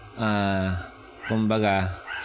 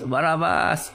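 higher crowd cry of the name; mock crowd call; 6 semitones higher than usual. Crowd call heard by Barabbas.

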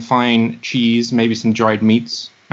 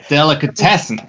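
put-on impersonation of an accent, after a laugh; hammed-up mimicked accent, done on request; [name] accent; 2 semitones higher than usual. Shown as a demonstration. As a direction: say it in an imitated accent, played for laughs. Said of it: "Do the, uh, the [name] accent".

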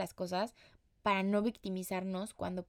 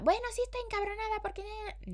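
much higher, mocking the overthinking inner voice; mock suspicious thought; 12 semitones higher than usual. Mocking her own overthinking to listeners, mock high suspicious thought. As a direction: squeak out the jumped-to conclusion.

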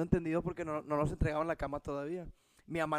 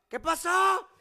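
higher, louder mock call from above; wife calling from upstairs; 11 semitones higher, 7 dB louder, much slower than usual. Wife calling down from upstairs after the bang, raised mock call. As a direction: call down the stairs, alarmed. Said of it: "desde arriba gritándome".

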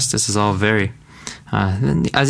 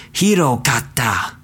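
pained; 2 semitones higher than usual. Shown as a demonstration. Teacher showing learners how to say it with emotion. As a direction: let it sound truly awful. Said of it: "Say it with a little bit of emotion".

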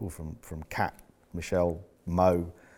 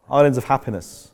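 louder, laughing through the phrase; amused repeat; 5 semitones higher, 6 dB louder than usual. Real, not acted. Repeating the author's phrase to his team, amused and laughing. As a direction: chuckle as you say it again.

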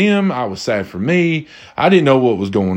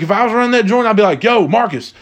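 high-pitched urgent order to a worker; mock-frantic calling out, pitched well up; pretend urgent boss; 8 semitones higher than usual. Imagined restaurant boss sends worker for beer, calling out urgently.

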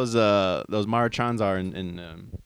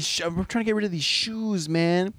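homeless guy voice; 6 semitones higher than usual. Retold or imitated. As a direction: raise the pitch and drag it out. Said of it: "And he was like".

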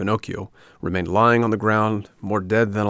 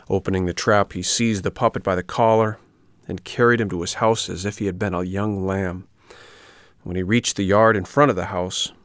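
plain reading voice

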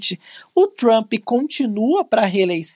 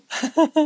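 teasing laugh; 7 semitones higher than usual. Real, not acted. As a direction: laugh lightly after your own teasing. Laughs after mimicking co-host's stumble.

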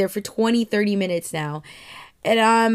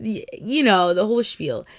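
ordinary talk

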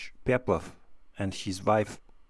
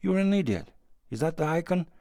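higher-pitched narrated anger; angry; 7 semitones higher than usual. A narrator's reading. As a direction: scold your wife in a raised pitch. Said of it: "he said angrily to his wife".

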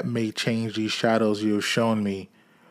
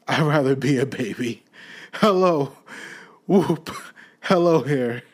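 giddy babble; 2 semitones higher than usual. Acted. Scrooge babbling in giddy joy.

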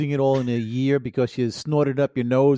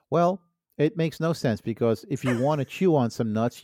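ordinary talk

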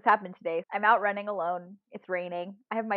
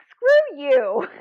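higher, louder mock indignant snap; mock retort; 7 semitones higher, 10 dB louder than usual. Answering her watch's verdict, louder mock indignation. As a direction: tell the watch off in play outrage.

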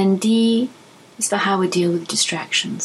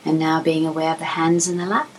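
calm, even voice with long pauses; unhurried phrases, each left to settle; guided meditation. Acted. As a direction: guide them gently through body and breath.

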